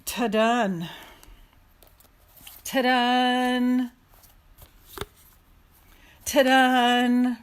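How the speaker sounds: playful loud fanfare. Pretend. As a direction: trumpet the reveal. Fanfare after the card reading.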